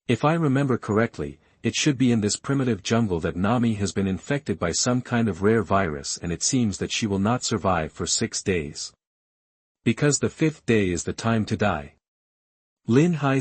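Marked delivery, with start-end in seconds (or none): none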